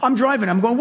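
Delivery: usual speaking voice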